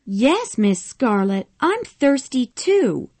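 Prissy complaining she is thirsty too; crying out. A narrator's reading. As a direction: whine it out. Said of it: "Prissy cried".

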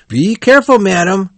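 high, urgent warning cry; alarmed cry. A narrator's reading. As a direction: call out sharply in alarm. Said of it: "cried one of the men".

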